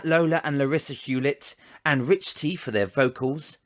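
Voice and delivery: ordinary delivery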